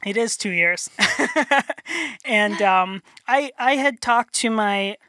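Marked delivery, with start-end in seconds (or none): 1.0-2.2 a light, slightly higher chuckle; short laugh. Real, not acted. Chuckling after confirming the two-year program. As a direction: laugh after a plain answer.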